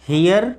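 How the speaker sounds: हेयर as hi-yar with sounded r; r pronounced. Shown as a demonstration. Teacher showing the reading to avoid, हेयर with r pronounced. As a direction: voice the final r in हेयर. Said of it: "हेयर नहीं पढ़ करके".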